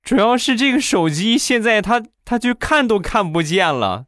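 high pleading whine; mock whiny plea.

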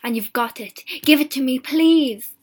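rising, insistent begging; urgent pleading. A narrator's reading. Wife begs for the paw.

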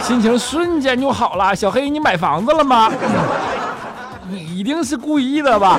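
high, loud comic indignation; mock outrage. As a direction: protest like you've been mocked, hammed-up. Mock outrage at teasing about housing.

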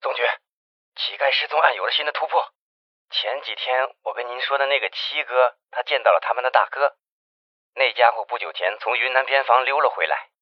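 quiet, hurried report over a phone line; hushed phone call. Acted. Investigator Jiang reports news to the chief by phone, hushed.

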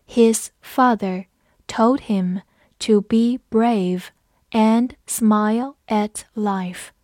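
slow, phrase by phrase with clear gaps; slow read-along. Shown as a demonstration. Teacher leads learners through the example sentence, slow reading. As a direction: read it slowly so learners can follow. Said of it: "跟着我慢读一遍".

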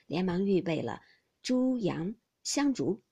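usual speaking voice

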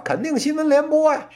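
normal talking voice

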